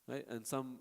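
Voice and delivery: ordinary talk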